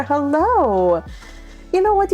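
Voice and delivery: usual speaking voice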